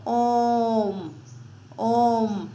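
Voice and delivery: ओम held long on the vowel; drawn-out om. Shown as a demonstration. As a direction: stretch ओम for three beats. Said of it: "कैसे?". (ओम को लंबा खींचकर)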